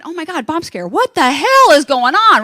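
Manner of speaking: mock panic swelling on the last words; retold alarm. Thinking there's a bomb scare, rising mock alarm.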